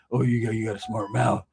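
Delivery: low impression of the huge boss; a low warning, imitating the big man; big man's voice. Huge boss challenging a cheeky newcomer, in a low voice. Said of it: "that's how he talked".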